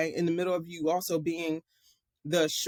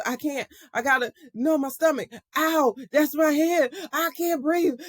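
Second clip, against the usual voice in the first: put-on high, louder wail of a panicking boy; imitated panic; 7 semitones higher, 4 dB louder than usual. Younger brother pinned by his older brother, mock panicked whine. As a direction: act out a child about to cry, pitch climbing. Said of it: "and panicking almost".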